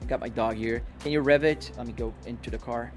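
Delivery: ordinary talk, nothing marked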